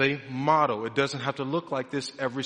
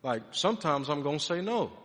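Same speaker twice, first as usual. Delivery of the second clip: ordinary talk, nothing marked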